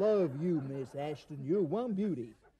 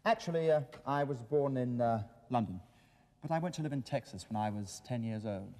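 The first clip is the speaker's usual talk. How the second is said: put-on lower, plain English accent; dropped American accent; 5 semitones lower than usual. The guest drops his act and answers the host in an English accent. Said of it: "what happened to your American accent".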